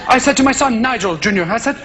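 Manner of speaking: affected English broadcaster, mostly low and quick; Nigel, radio preacher. Acted. Nigel telling listeners of his match, affected preacherly fashion. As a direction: parody a patronising religious radio talk. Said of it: "tell you all about God in this strange fashion".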